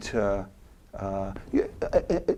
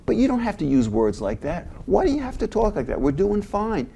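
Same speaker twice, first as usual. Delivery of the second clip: higher, pressing plea; Michael pleading; 10 semitones higher than usual. Retold or imitated. Michael pressing Archie again, voice pitched high and pleading. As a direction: urge him gently but firmly. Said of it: "so Michael says".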